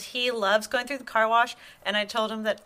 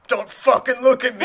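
hostile adult; 7 dB louder than usual. Acted. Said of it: "when you become adults".

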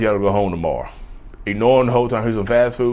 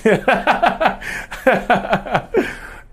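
high-pitched rising laugh; 13 semitones higher than usual. Real, not acted. Bursts out laughing.

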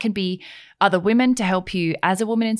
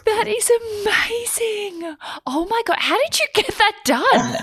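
amazed. Real, not acted. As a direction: marvel at it, delighted. Amazed by brain prints.

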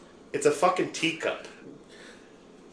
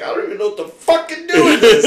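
high, loud, laughing panic; laughing freak-out; 9 semitones higher, 10 dB louder than usual. Retold or imitated. Retold friend freaking out, laughing.

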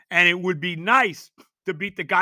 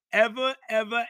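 ordinary talk, nothing marked

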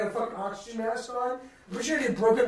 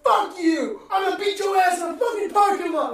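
high, loud threatening shout; angry threat yelled; 9 semitones higher, 7 dB louder than usual. Retold or imitated. Player threatening the fan from the bench, angry yell. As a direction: roar the threat at full volume. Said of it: "He sits up in a chair and goes".

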